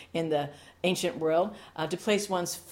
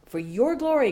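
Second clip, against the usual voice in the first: loud, higher cry of praise; calling out; 7 semitones higher, 7 dB louder than usual. Acted. Pastor crying out in prayer, loud and high. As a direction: lift it up to God.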